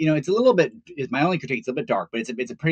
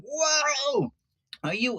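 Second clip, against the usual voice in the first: imitating the kid; 14 semitones higher, much slower than usual. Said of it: "he was like".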